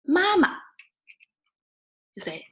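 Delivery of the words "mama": "Mama" high, then a short, quick last syllable; "Mama" with a toneless, fast second syllable; neutral tone ending. Shown as a demonstration. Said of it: "short and fast".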